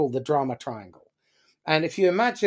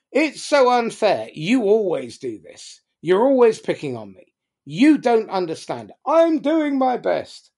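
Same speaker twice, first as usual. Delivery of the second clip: higher and louder, whining blame; whiny accusing quarrel; 6 semitones higher, 4 dB louder than usual. Shown as a demonstration. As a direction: swap between victim and persecutor. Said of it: "people just swap positions".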